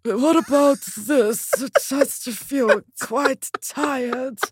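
slow, drained character impression; Eddie Redmayne. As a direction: stretch each word out as if worn out. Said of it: "You almost have too much energy".

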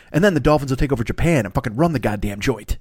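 ordinary talk

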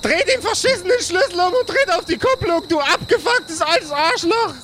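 high furious yell. Acted. Sille explodes at bandmate.

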